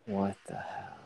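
ordinary talk, nothing marked

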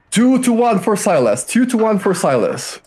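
high-pitched bet-taker's cry; screaming bookmaker. Acted. Man taking bets at a fight, screaming the odds. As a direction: call out the odds over a crowd. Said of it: "He's just screaming".

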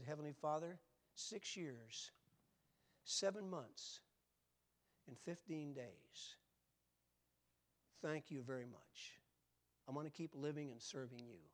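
soft, measured thanks with long pauses; sincere prayer. Retold or imitated. Preacher recalling his morning prayer after a heart attack, softly sincere. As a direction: count it out quietly and mean it. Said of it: "was really sincere".

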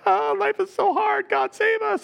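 high, whining plea to God; whiny pleading slaves. Retold or imitated. Israelites in Egypt begging God to save them, whiny and high. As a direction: moan it like desperate slaves begging.

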